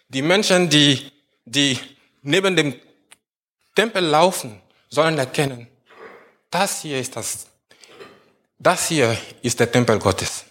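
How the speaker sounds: usual speaking voice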